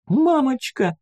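put-on very high, tiny child's squeak; squeaking little girl. A narrator's reading. Small girl calling for mummy in sudden darkness, high squeak. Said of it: "пискнула девочка".

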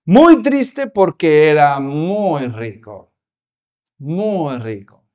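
"muy" drawn out slow before rico; slow, weighty stretch on "muy"; stress on muy. Shown as a demonstration. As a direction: drag "muy" low and long.

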